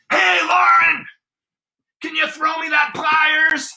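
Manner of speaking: high, loud far-off shout with no air to carry it; shouting across space. Pretend. Shouting to Lauren across empty space, loud and high. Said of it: "I was like".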